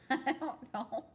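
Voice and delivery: laughing. Real, not acted. Laughing while answering.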